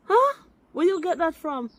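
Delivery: high disbelieving question; can't believe what she heard; indignant challenge; incredulous. Real, not acted. Heated call-in argument.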